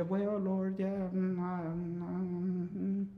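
soft, stalling hums; hesitant mumbling. Retold or imitated. Believer avoids telling the Lord the need, hesitant mumbling. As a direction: dodge the question with quiet, noncommittal humming. Acts a hesitant answer to Jesus.

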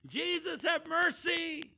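high, slow, loud holler; crying out. Retold or imitated. Leper drowning out those who hush him, crying out. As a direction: stretch the plea out at full voice. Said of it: "Crying out to Jesus".

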